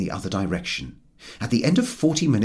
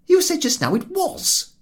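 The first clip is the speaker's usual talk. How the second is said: mild reproach, higher; hurt accusation; a touch of reproach; reproachful; 4 dB louder than usual. A narrator's reading. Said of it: "said Harris reproachfully".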